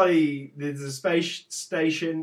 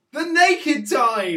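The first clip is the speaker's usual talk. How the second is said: slow, loud dramatic announce; title announcement; 9 semitones higher, 8 dB louder, slower than usual. Acted. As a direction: announce it like a trailer.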